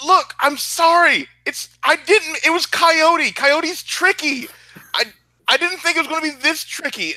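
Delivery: high, nervous apologetic voice; flustered pleading. Acted. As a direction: babble excuses in a panic. Panicked apology after being caught.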